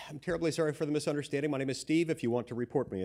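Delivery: rushed imitated apology, no pauses; one hurried breath. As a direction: blurt it all out in one fast breath. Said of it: "he said in one hurried breath".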